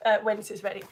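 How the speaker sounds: ordinary talk, nothing marked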